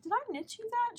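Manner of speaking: high-pitched, curious query; retold surprised question. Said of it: "I was like".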